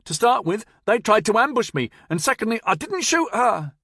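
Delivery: high, loud frantic excuses; babbling. Acted. Nevin pleading his innocence, high and frantic. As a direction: spill excuses, panicked. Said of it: "he babbled".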